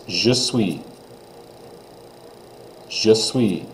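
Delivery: je suis with stress on je; teacher demo; French pronunciation. Shown as a demonstration. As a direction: model je suis.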